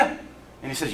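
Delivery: ordinary delivery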